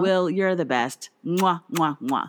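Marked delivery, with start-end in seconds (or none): 1.2-2.3 big wet smooch. Real, not acted. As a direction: blow him big kisses. Kiss blown to friend.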